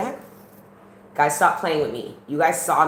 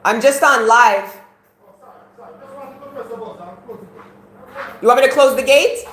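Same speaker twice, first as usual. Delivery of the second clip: high, loud call across the yard; 12 semitones higher, 12 dB louder than usual. Real, not acted. Streamer calling out loudly to family outside the house. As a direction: shout back to someone outside mid-stream.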